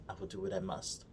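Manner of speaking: quiet, grave resolve; low and quiet, with gravity; solemn hushed voice. Retold or imitated. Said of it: "Obi-Wan's like".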